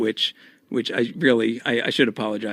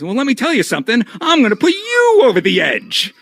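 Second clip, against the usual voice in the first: imitating a hammed-up wrestling promo, much higher; hammy dad promo; 11 semitones higher, 5 dB louder than usual. Cena's dad threatening Edge in the vignette, hammed-up high voice. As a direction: pitch it way up and ham it like an amateur actor. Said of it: "and then he goes".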